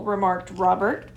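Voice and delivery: usual speaking voice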